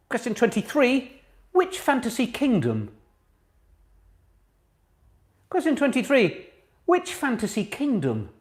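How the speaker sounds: high, slow, deliberate question reading; quizmaster announcement. Real, not acted. Host reads the question to quiz players, as a deliberate quizmaster. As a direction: read it out with measured, spaced emphasis.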